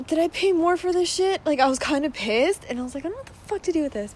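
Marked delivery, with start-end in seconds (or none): 0.1-1.4 higher, annoyed question; indignant, suspicious of the price. Retold or imitated. Annoyed quote about paying more. 3.1-4.1 fast, higher, softer grumble; exasperated. Retold or imitated. Annoyed quote, fast and soft.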